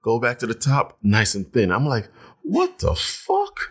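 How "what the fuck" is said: very high, drawn-out disbelief; slow squeal of disbelief, replayed; retold shock. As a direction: stretch it out in high-pitched shock. Acts out shock at thinning hair.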